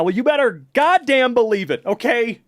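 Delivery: high-pitched, emphatic hype; mock insistence. Answering a viewer who can't believe it, mock insistence. Streamer answers a disbelieving chat message.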